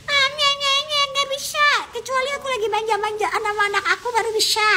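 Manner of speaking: put-on very high, squeaky, whiny little-girl voice; tiny mami voice. Shown as a demonstration. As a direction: put on a babyish, clingy squeak far above your own voice. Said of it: "Pakai suara mami yang kecil itu". (suara kecil manja, cempreng menye-menye)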